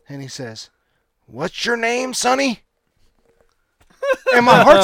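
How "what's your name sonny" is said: put-on high, shaky-free old man voice lightly raised; a hint of a frail old man; old man asking.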